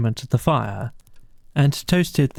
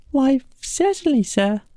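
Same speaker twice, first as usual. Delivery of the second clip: much higher squeaky-small mouse voice, respectful; respectful field mouse; 13 semitones higher than usual. Acted. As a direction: play a tiny polite field mouse. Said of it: "replied the field mouse respectfully".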